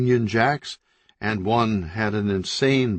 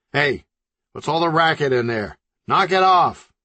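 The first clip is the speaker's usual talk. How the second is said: higher, louder yell through the wall; 6 semitones higher, 4 dB louder than usual. Acted. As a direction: bellow at noisy neighbours. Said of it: "he yelled".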